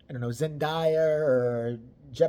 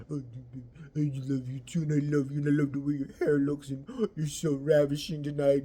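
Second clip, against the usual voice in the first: mock lovey-dovey whisper; 3 semitones lower than usual. Mocking lovey couple.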